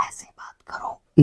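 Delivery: soft breathy whisper, slowed down; whisper demo. Shown as a demonstration. Teacher demonstrating to students what whispering sounds like, whispered. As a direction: drop to a whisper to show the word. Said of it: "इस तरीके से जब हम बात करते हैं तो whispering".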